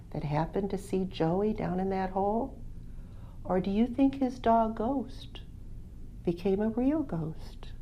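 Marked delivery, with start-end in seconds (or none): 3.4-7.7 soft breathy storyteller whisper; whispering the ghostly twist to listeners; spooky whisper. A narrator's reading. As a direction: lean in and whisper the mystery.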